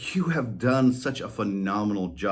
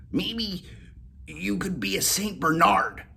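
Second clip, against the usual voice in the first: higher, lightly voiced collie; dog voice suggesting; 9 semitones higher than usual. Molly suggesting ideas to Whiskers, higher voice. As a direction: offer an idea.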